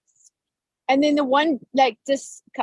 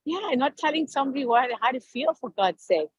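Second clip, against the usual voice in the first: normal talking voice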